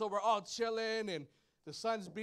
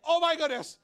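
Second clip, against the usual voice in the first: laughing exclaim; 6 semitones higher, 10 dB louder than usual. Retold or imitated. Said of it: "I'm like".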